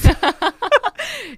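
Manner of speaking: risada aguda e alta. Real, not acted. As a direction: ri alto de si mesma. Ri do próprio alívio. (gargalhada aguda)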